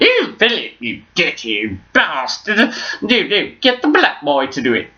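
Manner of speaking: high mock royal scolding; hammed-up Queen teasing her husband. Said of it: "the Queen".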